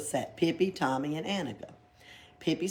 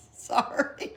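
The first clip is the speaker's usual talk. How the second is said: usual speaking voice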